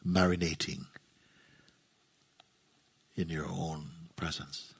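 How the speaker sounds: low, soft phrases, each left to settle; guided meditation. Real, not acted. Teacher guides listeners into resting in their own presence, guided meditation. Leads a silent guided contemplation.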